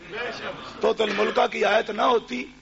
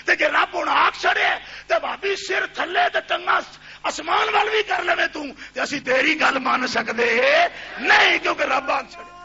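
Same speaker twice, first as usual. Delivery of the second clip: higher, louder Punjabi sermon cry; fiery preaching; 6 semitones higher, 3 dB louder than usual. Acted. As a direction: hammer it home to the crowd in Punjabi. Preacher at full force in Punjabi.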